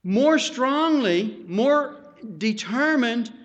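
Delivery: high, loud, slow pulpit build; full-force preacher's exhortation; fiery preaching. Acted. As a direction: drive it home to the congregation.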